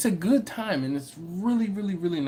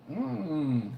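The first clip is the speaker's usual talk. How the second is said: normal talking voice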